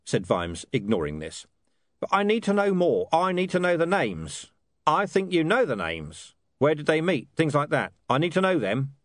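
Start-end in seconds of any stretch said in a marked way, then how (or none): 2.0-9.0 lightly voiced stern commander; pressing commander. Commander Vimes to William, a hint of stern pressure. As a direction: press him for names.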